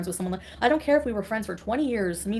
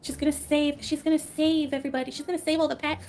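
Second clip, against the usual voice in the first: higher, mocking sing-song; sarcastic mockery; 6 semitones higher than usual. Streamer mocking a rival's pet rescue in a high sarcastic voice. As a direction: sneer at her grand promises.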